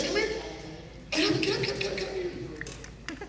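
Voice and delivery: high, soft coaxing get up; gentle high-pitched urging. Real, not acted. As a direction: lift him up with a sing-song voice. Coaxing hypnotized volunteer up.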